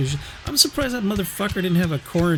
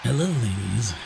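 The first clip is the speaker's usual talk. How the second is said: mock sleazy greeting; 5 semitones lower than usual.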